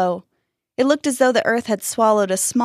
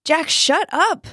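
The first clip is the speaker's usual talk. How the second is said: high, slow, firm order; exasperated command; 9 semitones higher, slower than usual. A narrator's reading. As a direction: order a panicking friend to stop. Friend stops panicking boy's shouting.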